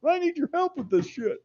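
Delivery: lightly hammed-up wife complaining; imitating wife.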